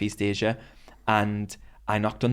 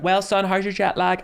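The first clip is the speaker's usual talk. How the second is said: higher motherly voice; lightly voiced mum, higher; mum's voice; 9 semitones higher, 4 dB louder than usual. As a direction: play mum checking in. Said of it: "she was like".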